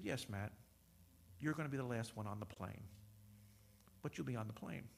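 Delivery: low, soft assurance, voicing God; hushed divine reply. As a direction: reassure in a deep, quiet voice. Retells God's reassuring answer.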